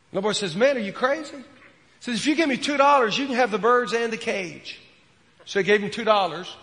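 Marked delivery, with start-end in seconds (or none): 0.5-1.4 put-on high incredulous kid voice; a boy who can't believe his luck; boy's disbelief. Retold little boy voiced. 2.1-4.8 put-on high, loud kid voice grabbing the deal; hammed-up eager little boy.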